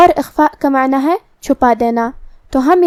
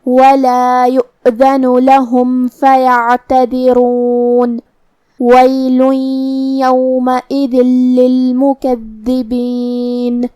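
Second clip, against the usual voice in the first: slow melodic tajweed chant; Quran recitation; slower than usual. Acted. Teacher recites the next verses as a model.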